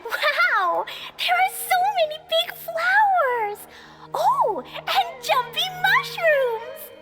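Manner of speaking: high, drawn-out wonder; wide-eyed excitement. Acted. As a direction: marvel at every new sight like an excited child. Child marvels at new land.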